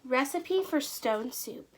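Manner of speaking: ordinary delivery, nothing marked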